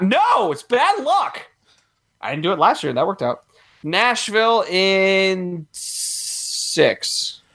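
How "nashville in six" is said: very slow, stretched-out prediction; slow hesitant Nashville in six; drawn-out pick. Real, not acted. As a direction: drag out each word while deciding. Slow deliberate pick, then repeated.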